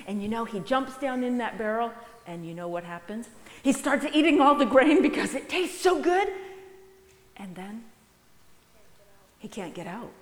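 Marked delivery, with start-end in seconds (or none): 3.6-6.3 excited. Acted. Mouse gorging in the story.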